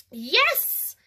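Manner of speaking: put-on high, loud child's yes; shouted. A narrator's reading. Cassie shouts her happy yes at Aunt Denise. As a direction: shout it like a thrilled toddler. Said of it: "Cassie shouted".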